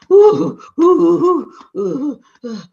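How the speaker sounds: high, loud panting ooh; worn-out husband catching his breath. Acted. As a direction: gasp for air after the chase. Plopped down to get breath.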